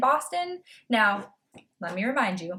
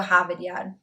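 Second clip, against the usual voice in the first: Harvard Yard r-less, "Hahvad Yahd"; Boston accent; 2 semitones lower than usual. Shown as a demonstration. Vlogger repeating the place name Boston-style, Boston accent. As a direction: say it like a Bostonian, dropping every r. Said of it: "a guide on how to speak Boston".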